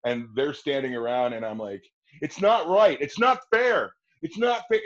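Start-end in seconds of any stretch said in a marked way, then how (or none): 2.2-4.8 high, loud outcry on not fair; impassioned protest. Shown as a demonstration. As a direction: wail it at the officers. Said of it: "I played it more serious".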